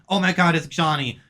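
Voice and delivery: ordinary talk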